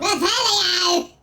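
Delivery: slow, drawn-out shouted incantation; shouted spell. Pretend. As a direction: bellow the spell like a wizard. Shouting the spell along with game.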